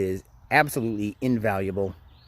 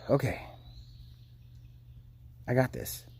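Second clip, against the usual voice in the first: softer sigh then quiet self-talk; calming sigh; 11 dB quieter than usual. Retold or imitated. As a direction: breathe out and steady yourself. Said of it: "and you just go".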